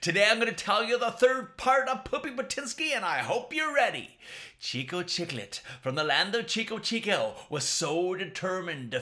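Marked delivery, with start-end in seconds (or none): 0.0-4.2 put-on high, loud host greeting to kids; bouncy children's show intro; kids' show host.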